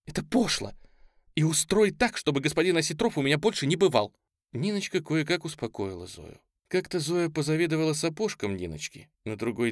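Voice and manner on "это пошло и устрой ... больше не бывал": высокое, слегка слезливое возмущение; требовать повышенным голосом, на грани слёз; со слезами. A narrator's reading. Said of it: "Со слезами воскликнула она". (обиженный высокий возглас)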